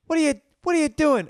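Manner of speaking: high, loud shocked mother shrieking Joshua's name; mother shouting her son's name in disbelief; shocked mom. Retold or imitated. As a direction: do his mom catching him naked, voice way up and loud. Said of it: "And she's like".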